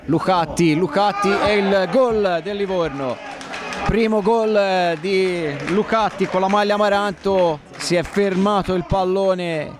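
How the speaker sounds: rising play-by-play over the goal; breathless italian goal commentary. Acted.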